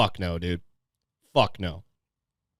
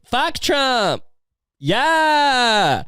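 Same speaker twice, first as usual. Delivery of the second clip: high, stretched-out rally cheer; mock cheer; 10 semitones higher, much slower than usual. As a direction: holler like an overexcited fan. Imitates the cheering girls again.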